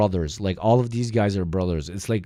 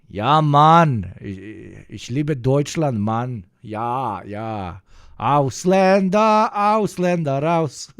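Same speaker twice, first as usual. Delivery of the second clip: loud, hammed-up German accent; 3 semitones higher, 6 dB louder, slower than usual. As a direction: shout it like a hammy German. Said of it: "give us your best German accent".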